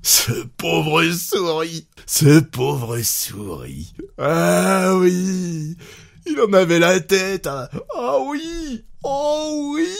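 high, squealing delight, words stretched out; shaking with glee. A narrator's reading. Husband mocking poor Souris, shaken by joy. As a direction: overcome with mirth at someone's expense. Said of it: "tout secoué par sa joie".

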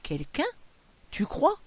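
doubtful, questioning lift at the end; unconvinced husband; incredulous. A narrator's reading. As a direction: query it in sleepy disbelief. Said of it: "Il demeurait incrédule".

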